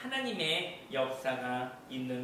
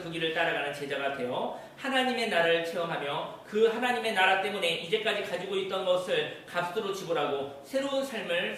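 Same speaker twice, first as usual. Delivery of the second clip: raised, driving sermon exhortation; preaching; 3 semitones higher, 4 dB louder than usual. Real, not acted. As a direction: build a fervent blessing, then ease down at the close. Preacher's climactic exhortation and blessing. (힘주어 축원하는 설교조)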